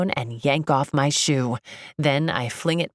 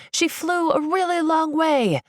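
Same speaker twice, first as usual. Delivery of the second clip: high, sustained call; excited, high-pitched mild shout; fans shouting; 11 semitones higher than usual. A narrator's reading. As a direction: call it out over a crowd. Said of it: "they shout".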